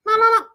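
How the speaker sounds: short, high, loud ring; phone ring imitation. The phone rings in the teen's house, high imitated ring. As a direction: mimic a telephone's trill.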